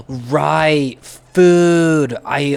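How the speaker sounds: loud, rising cry of sudden recollection; excited outburst. Real, not acted. Gamer suddenly remembering to eat, excited cry. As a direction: shout it like a eureka.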